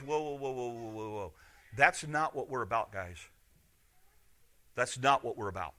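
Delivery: soft, low voice reining them in; gentle restraint. Retold or imitated. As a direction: imitate quietly calming down overeager followers. Jesus restrains overeager disciples.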